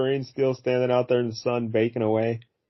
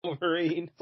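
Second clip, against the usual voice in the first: mock villain; 6 semitones higher than usual. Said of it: "mocking Pierce's defeat line".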